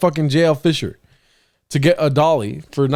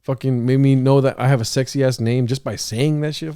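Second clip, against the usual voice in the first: normal talking voice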